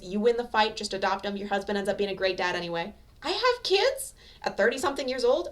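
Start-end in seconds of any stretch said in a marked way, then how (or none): 3.2-5.5 high, startled squeak of disbelief; shocked disbelief. A narrator's reading. Deku stunned to hear he has kids, high squeak. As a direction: gasp it out like the news floors you.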